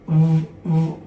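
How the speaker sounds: "v" as a buzzing voiced sound, lip bitten. Shown as a demonstration. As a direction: bite the lip and voice the "v". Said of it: "it means voice sound".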